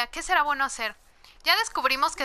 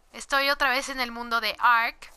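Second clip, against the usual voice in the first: ordinary delivery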